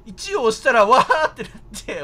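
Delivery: ordinary talk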